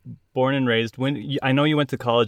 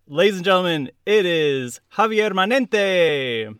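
announcer introduction; 5 semitones higher than usual. Acted. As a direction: present the guest like a star. Host introduces guest.

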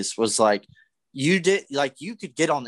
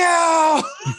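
shouted laugh; 6 dB louder than usual. Retold or imitated. Laughs after yelling insult.

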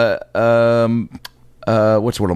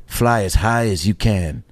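ad slogan; slower than usual. Pretend. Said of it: "mine would be for Winter Pelican".

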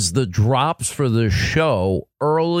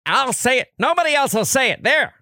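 much higher, fast ranting voice; radio host impression; 9 semitones higher than usual. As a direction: rant it up high and quick. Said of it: "here I can do the".

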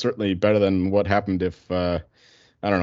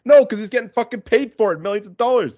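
angry rant; 10 semitones higher, 5 dB louder than usual. Real, not acted. Ranting about coach pay.

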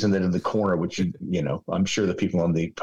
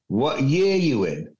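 imitated English schoolboy accent, higher and slow; 4 semitones higher, slower than usual. Schoolmate greets the new American arrival, English accent. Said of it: "with this English accent".

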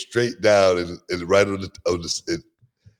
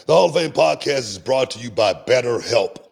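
upbeat, louder sponsor read, higher; 8 semitones higher, 5 dB louder than usual. Acted. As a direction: pitch the sponsor like a radio host.

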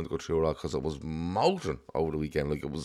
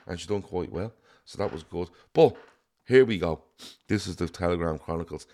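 normal talking voice

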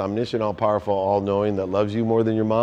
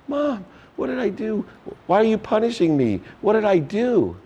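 imitating a wailing hurt child, much higher; crying little boy; 10 semitones higher than usual. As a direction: plead like a small boy just slapped. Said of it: "I started to cry immediately".

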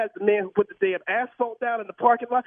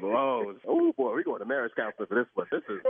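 low, defeated groan; pranked husband groaning about his wife; deflated; 11 semitones lower than usual. Real, not acted. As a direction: sink down in rueful defeat. Victim deflates after prank reveal.

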